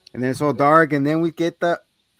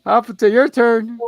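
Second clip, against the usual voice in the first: mock hot potato; 9 semitones higher, 8 dB louder than usual. Echoing the mock toss.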